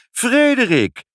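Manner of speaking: high, loud, drawn-out name; astonished cry. A narrator's reading. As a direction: cry out in amazement. Wife exclaims at sudden cure.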